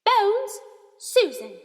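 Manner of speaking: loud, high roll call; teacher reading out a list loudly; calling out names. A narrator's reading. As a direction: call the name across the hall. McGonagall calls names aloud.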